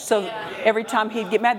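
ordinary talk, nothing marked

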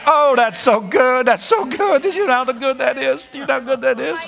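soaring high, delighted and amazed; high excited delight. Real, not acted. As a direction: lift the voice high in pure delight. Preacher exulting over the word.

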